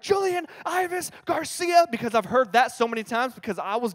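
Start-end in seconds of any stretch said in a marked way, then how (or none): 0.0-1.9 high, slow drawn-out full-name call; mom calling full name. Retold or imitated. Mom summoning her son, high drawn-out call. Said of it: "as soon as I heard".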